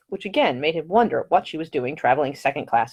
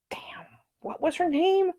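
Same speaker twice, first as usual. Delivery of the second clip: slow, hushed inner aside; whispered thought; 14 semitones higher, 5 dB quieter, slower than usual. A narrator's reading. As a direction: mutter it to yourself. Nick's private thought.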